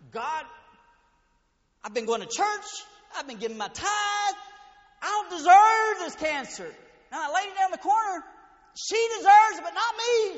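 loud, high indignant complaint; bitter aunt. Retold or imitated. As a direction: whine like you've been cheated by God. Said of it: "she's like".

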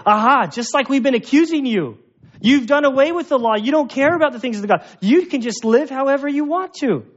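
accusing critics. Retold or imitated. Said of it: "the second group would say".

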